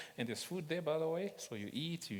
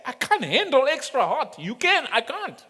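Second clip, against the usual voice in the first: higher, louder mock protest; playful plea; 9 semitones higher, 11 dB louder than usual. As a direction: tease like the wife begging off heat. Laughing, voicing wife's plea.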